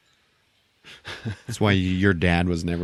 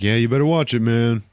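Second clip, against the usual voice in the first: raised, louder telling-off; acted warning; 3 semitones higher, 5 dB louder than usual. Sidekick defending the captain, lightly acted sharp warning. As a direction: warn them off, half in earnest.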